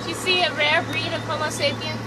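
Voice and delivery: ordinary talk, nothing marked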